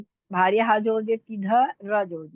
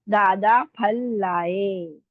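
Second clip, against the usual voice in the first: slow dictation; much slower than usual. Shown as a demonstration. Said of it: "अगर हम फूल लिखना है".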